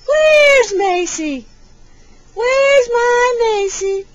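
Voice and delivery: high, loud singsong question; stretched-out, high baby talk; peekaboo searching. Pretend. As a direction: call playfully for a hiding baby.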